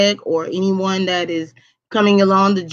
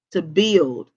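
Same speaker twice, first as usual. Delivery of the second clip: ordinary talk, nothing marked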